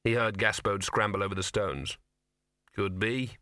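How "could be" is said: grudging. A narrator's reading. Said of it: "said the dog grudgingly".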